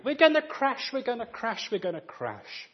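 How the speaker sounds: high mock panic, fading out; hammed-up frightened child crying out; panicking child. Child panicking in a storm on the coach, mock fright.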